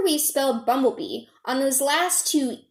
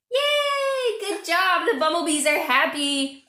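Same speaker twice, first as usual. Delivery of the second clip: much higher cheering bee voice; bumblebee puppet voice; 4 semitones higher than usual. Pretend. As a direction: squeal with joy as the little bee.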